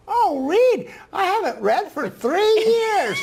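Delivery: put-on high, slow frail old lady; old woman voice. Guest imitates the elderly reading student, high and slow. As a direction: voice the ninety-four-year-old gently. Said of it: "I'm gonna use a voice that s-, sounds like her".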